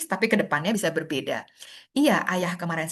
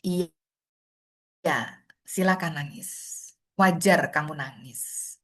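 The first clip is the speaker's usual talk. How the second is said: reassuring; 2 semitones lower than usual. Retold or imitated. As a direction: let them cry, gently. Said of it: "dipegang tangannya ... dipeluk". (pendamping menenangkan anak yang menangis, lembut dan pelan)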